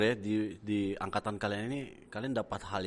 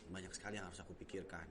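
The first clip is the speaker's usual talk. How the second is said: very soft, low confiding; demonstrating a hushed, intimate prayer; quiet personal prayer; 4 semitones lower, 16 dB quieter than usual. Shown as a demonstration. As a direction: murmur it privately, as if to God. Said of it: "cuma komunikasi".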